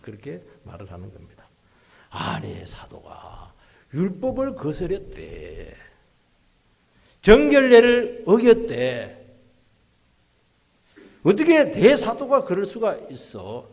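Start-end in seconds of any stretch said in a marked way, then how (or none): 2.1-13.7 slow, mock-outraged complaint, climbing higher and louder; long, loud gossip. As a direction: imitate a scandalized rumor-spreader, stretching every word. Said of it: "길게 막 큰 소리로 막".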